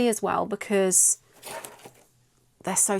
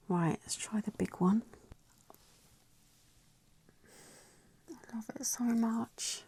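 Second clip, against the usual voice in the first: whispering; 10 dB quieter than usual. Real, not acted. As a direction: breathe it in awe. Hushed delight over the stamp.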